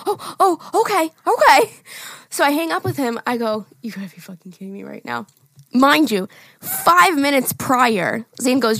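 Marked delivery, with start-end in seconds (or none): 0.1-1.7 high, taken-aback "oh, okay"; flustered reaction. Retold or imitated. Man on phone hears of an accident, flustered. 3.8-5.3 quick, hushed disbelief; exasperated aside. Retold or imitated. Driver to self after hanging up, exasperated aside.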